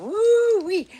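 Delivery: loud, high whoop; exclaiming. Real, not acted. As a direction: let out a big delighted holler. Exclaims after the story.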